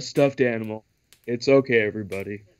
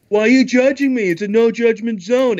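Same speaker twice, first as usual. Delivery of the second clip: indignant; 5 semitones higher, 3 dB louder than usual. Retold or imitated. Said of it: "And he's like".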